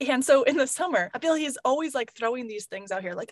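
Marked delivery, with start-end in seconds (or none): none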